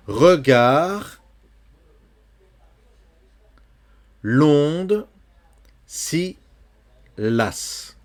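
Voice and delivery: vers lu lentement, détaché; poem reading. Shown as a demonstration. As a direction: lire le vers avec soin. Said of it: "lecture du poème". (lecture de poème appuyée)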